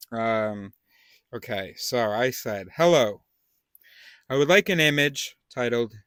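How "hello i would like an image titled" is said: louder, higher-pitched hello opening a request; bright greeting. Retold or imitated. Reading the message sent to an image generator, loud cheery greeting. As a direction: read your message out with a loud, lifted start.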